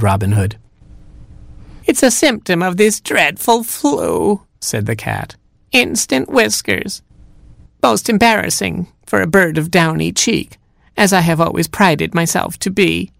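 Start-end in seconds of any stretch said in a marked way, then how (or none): 1.8-4.4 high, slow sickly granny; cat posing as grandma. Acted. Cat blames the flu to Robin, high mock-granny voice. 5.7-13.1 cat posing as grandma. Acted. Cat blames the flu to Robin, high mock-granny voice.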